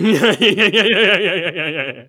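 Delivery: high, loud giggling yeahs; nervous laughter. Pretend. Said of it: "Why am I laughing?".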